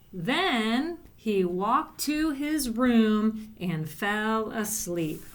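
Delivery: very slow, word by word; slow dictation. Shown as a demonstration. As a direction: dictate slowly so kids can write. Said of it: "Next sentence".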